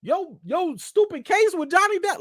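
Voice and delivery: high-pitched put-on frustrated sigh; exasperated director. Director frustrated about the Johnny Depp case, exasperated high voice. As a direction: groan it like a fed-up director. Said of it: "the director probably was sitting here like".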